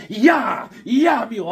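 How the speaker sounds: high, loud imitation of a wife; wife's nagging holler. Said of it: "the wife is like".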